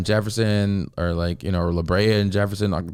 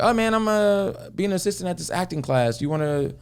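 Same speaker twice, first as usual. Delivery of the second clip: retold friend's request; 11 semitones higher than usual. Friend asking help at acting class, casual request. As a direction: voice a pal floating a favour, pitched up.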